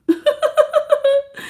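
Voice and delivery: high-pitched giggle; high giggling; high laughter. Real, not acted. Laughing at the chat.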